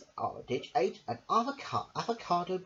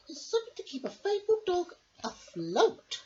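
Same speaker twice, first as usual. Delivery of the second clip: much higher, squeaky little lady voice; Missus Armitage voice. Acted. Missus Armitage asks her dog a question, high and squeaky. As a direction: pipe it up like a fussy little lady. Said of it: "said Missus Armitage".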